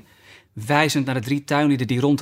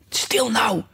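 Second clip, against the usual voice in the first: high, alarmed hush; startled whisper; 8 semitones higher than usual. A narrator's reading. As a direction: shush in fright, pitch leaping up. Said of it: "fluisterde het konijn geschrokken". (geschrokken fluisterend gesis)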